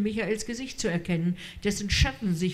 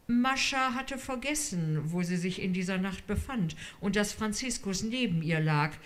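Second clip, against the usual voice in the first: ordinary delivery, nothing marked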